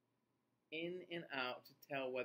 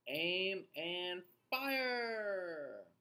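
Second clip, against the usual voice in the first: slow, drawn-out mock battle cry; hammed-up slow battle order; dramatic command; 4 semitones higher, 5 dB louder, much slower than usual. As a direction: call it out like a cannon commander. Skunk spraying, dramatic call.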